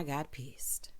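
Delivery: whispered aside, no voice at all. Real, not acted. Recapping the show to viewers, whispered aside. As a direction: drop to a breathy secret whisper. Aside before getting mad.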